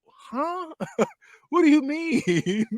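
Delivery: high, drawn-out mock bewilderment; exaggerated high-pitched double take; stunned disbelief. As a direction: squeak it out slowly, hammed-up confusion. Acting out stunned reaction to line.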